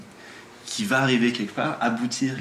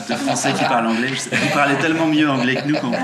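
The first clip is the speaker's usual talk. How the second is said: laughing; 5 dB louder than usual. Real, not acted. Self-mocking accent apology, laughing.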